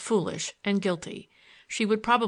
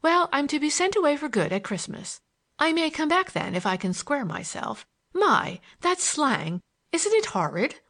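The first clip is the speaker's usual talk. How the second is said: lightly voiced girl, pitched higher; chatty mischievous girl; 6 semitones higher than usual. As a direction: play the schoolgirl gossiping about her fate.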